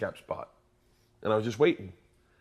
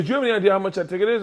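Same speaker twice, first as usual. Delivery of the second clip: much higher, very fast mock outrage; angry cop; 9 semitones higher, 5 dB louder, much faster than usual. Cop demanding if he knows the fine, mock angry. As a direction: fire it off like a worked-up cop. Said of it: "The cop came up very angry".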